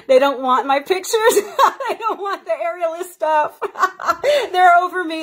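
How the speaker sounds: real giggling while joking she's unwanted; laughing. Real, not acted. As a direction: laugh through the self-pity. Laughing at her own insecurity.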